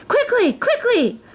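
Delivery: high, urgent call to the guards; queen calling her guards in a rush; calling urgently. A narrator's reading. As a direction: hurry them along. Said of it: "called the queen to her guards".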